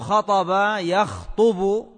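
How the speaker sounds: خطب يخطب said slowly, khataba yakhtubu; damma on ط. Shown as a demonstration. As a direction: stretch out خطب يخطب, with u after the ط in يخطب. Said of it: "بضم الطاء".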